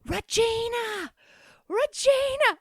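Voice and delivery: a panicked mother screaming her daughter's name down the line; frantic screaming woman. Retold or imitated. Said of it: "screaming in the phone".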